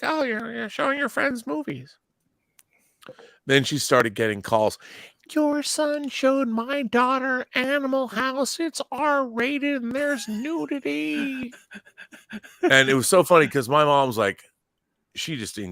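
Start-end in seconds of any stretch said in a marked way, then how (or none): 0.0-2.0 high, airy mom voice, much higher pitch; imitating mom. 5.3-11.5 high, slow, whispery shocked parent; mock scandalized parent. Imitating complaining parents' calls.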